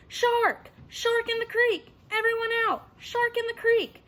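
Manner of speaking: high, loud alarm call; old captain raising the alarm; captain shouting. A narrator's reading. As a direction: bellow the warning from a boat. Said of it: "Captain Wilson's voice shouting".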